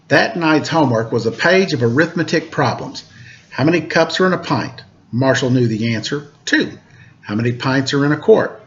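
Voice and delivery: lively read-aloud storytelling voice; storyteller reading a picture book to kids; story narration. A narrator's reading. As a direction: read it to children, bright and clear.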